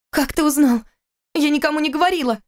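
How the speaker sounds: indignant. Acted. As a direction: she bristles at being found out. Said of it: "возмутилась она". (возмущённо, заметно выше)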